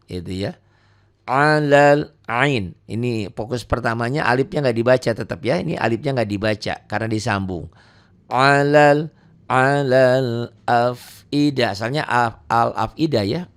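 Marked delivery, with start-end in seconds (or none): 1.2-2.8 slow tajwid chant; Quran recitation. Shown as a demonstration. 8.3-11.7 slow tajwid chant; Quran recitation. Shown as a demonstration. Recitation example.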